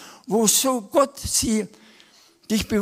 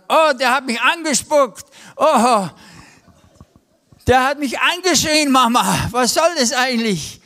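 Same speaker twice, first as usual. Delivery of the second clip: put-on whiny child; 3 semitones higher, 5 dB louder than usual. Kid in the car complaining to mom, loud mock whine. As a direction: play a sibling tattling to mom. Kids squabbling in the back seat.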